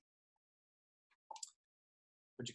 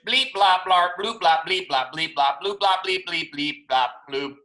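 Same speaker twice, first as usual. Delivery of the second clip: chanted robot bleeps and bloops; higher, mechanical robot babble; robot language; 3 semitones higher, 4 dB louder than usual. A narrator's reading. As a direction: rattle off nonsense syllables like a robot. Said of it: "in robot language".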